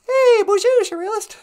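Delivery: chirpy robot sidekick offering a ride home; high, squeaky sidekick voice. Pretend. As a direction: squeak it like a tiny robot pal. Voicing the companion.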